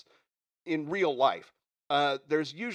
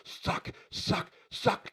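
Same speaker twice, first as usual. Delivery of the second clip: toneless, rasped repeats of the word; voiceless sucking; 6 semitones higher, 6 dB quieter, much slower than usual. Acted. Worker describing zombie lights draining his eyeballs, voiceless sucking. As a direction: squeeze the words out without voice.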